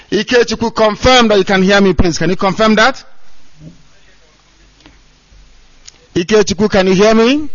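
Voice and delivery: calling out. Real, not acted. Calling to a caller on phone.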